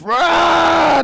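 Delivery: high, loud monster roar; mock Godzilla rampage roar. Imitates Godzilla raiding the kitchen.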